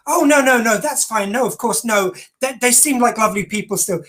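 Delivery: mock brave reassurance in a lifted voice; brave face. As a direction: put on a brave face, too quick to reassure. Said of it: "put a brave face on it".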